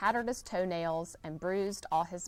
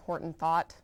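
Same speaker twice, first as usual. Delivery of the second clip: plain reading voice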